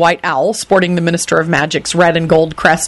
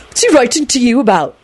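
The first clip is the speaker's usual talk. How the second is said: demanding; 7 semitones higher than usual. A narrator's reading. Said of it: "Neville demanded".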